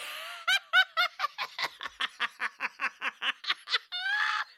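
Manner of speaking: long high shrieking laughter. Real, not acted. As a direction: lose it completely laughing.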